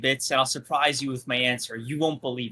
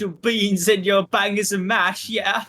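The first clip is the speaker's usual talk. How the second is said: higher put-on British accent, playful; put-on British accent, clearly pretend; 4 semitones higher than usual. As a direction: ham up a cockney lad. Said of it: "why do your accents devolve so quickly".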